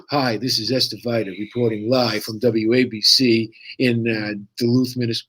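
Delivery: a hint of a live news report; mock TV reporter. Teasing his wife as a TV reporter, lightly mock anchor voice. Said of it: "I used to call her C N N Esther".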